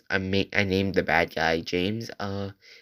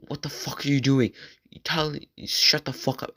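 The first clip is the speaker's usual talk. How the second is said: higher-pitched mock telling-off; retold angry scolding; 6 semitones higher than usual. Teacher turning on a silent student, mildly angry imitation. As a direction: imitate an irate teacher, without full shouting.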